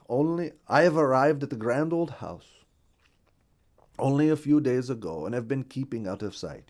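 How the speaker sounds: lightly voiced foreign bird newcomer; a hint of a stranger's voice, emphatic at first, then settling; Hungarian the Partridge. Newcomer partridge introducing himself to a bluebird, lightly voiced.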